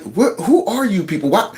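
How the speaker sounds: baffled mock outrage, pitch climbing to a high question; imitated disbelief. Voicing a guest stunned by the panelists' talk, incredulous. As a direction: act out her stunned incredulity. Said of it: "She's looking like".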